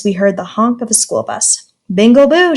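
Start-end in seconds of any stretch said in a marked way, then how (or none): 1.9-2.6 put-on bus driver calls out to the kids; loud call across the zoo; shouted. A narrator's reading. Bus driver calls the class to go, shouting. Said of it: "shouted our bus driver".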